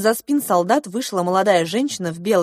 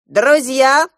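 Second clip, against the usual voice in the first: loud, raised announcement; proclaiming; 7 semitones higher, 8 dB louder than usual. A narrator's reading. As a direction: address everyone like a herald. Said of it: "возвестил гусь".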